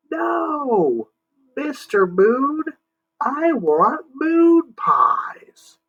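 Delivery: high, slow, drawn-out pleading cry; exasperated yell. Acted. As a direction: stretch it out, fed up. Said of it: "you don't have to yell".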